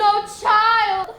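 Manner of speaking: bellowed stage demo, theatrical fall; teacher shows how to project; loud declaiming. Shown as a demonstration. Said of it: "That's it. Play to the balcony".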